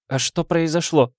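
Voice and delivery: high, lightly voiced young woman; mildly dazed, high questioning; murmuring young countess. A narrator's reading. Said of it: "пробормотала молодая графиня".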